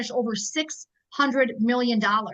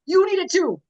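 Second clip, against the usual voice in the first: higher and louder push; urgent plea; 6 semitones higher, 4 dB louder than usual. Real, not acted. Urging her team to want freedom, higher and louder. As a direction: insist they need it. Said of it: "I'm telling you".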